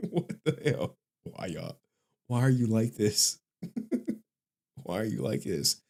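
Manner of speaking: ordinary delivery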